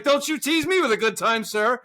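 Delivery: high, loud teasing line with a laugh in it; mock cheeky reply; hammed-up retort. Mock flirty retort in story.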